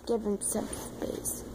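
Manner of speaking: soft unvoiced whisper. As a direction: whisper it as a hushed aside. Whispered aside about the bear.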